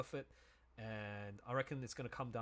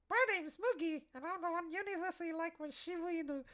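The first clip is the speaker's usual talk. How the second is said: much higher, squeaky mascot voice; high cutesy character voice. Pretend. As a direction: play a tiny cute animal introducing itself. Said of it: "And then it's like".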